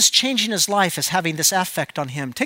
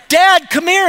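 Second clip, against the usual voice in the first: high, loud call across the house; child calling out; 8 semitones higher, 6 dB louder than usual. Retold or imitated. Son calling his dad from across the house, a high loud call. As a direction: yell for dad from the other room.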